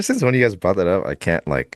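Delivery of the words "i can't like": normal talking voice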